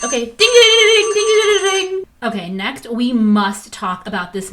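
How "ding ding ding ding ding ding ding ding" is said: much higher and louder bell dings; imitated bell ding. Vlogger cutting herself off with a loud mock timer bell. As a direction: sound the buzzer like a game show.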